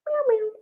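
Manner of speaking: squeaky high soft meow; high cat meow. Pretend. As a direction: meow like a tiny kitten. Cat noise in recap.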